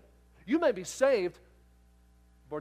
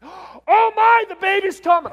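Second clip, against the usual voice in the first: high, loud cry of alarm; the nurse, checking the wife, shouting in shock; 13 semitones higher, 16 dB louder, slower than usual. Retold or imitated. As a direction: yelp as if caught off guard.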